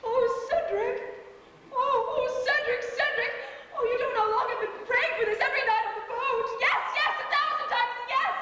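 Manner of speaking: high, swooning excitement; girlish gushing. Acted. Actress accepts her suitor's proposal by phone, ecstatic. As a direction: squeal with joy at a proposal. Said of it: "your girlish squeals".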